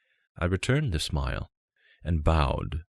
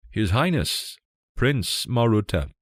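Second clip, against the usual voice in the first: a higher, louder formal call across the hall; herald's announcement; 6 semitones higher, 4 dB louder than usual. Acted. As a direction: announce the prince to the whole court. Servant announces royal arrival.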